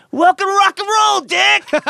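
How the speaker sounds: high, loud gleeful yell out the window; yelled taunt. Retold or imitated. Johnny leaning out a passing car, yelling at Dick, gleeful taunting yell. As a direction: bellow it at him as you drive past.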